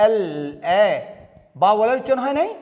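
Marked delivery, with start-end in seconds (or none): 0.1-0.9 এল, then its opening vowel এ alone; teacher separating the vowel এ from এল; pronunciation demo. Shown as a demonstration. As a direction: sound out এল and isolate the এ. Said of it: "এলটা উচ্চারণ করার সময়".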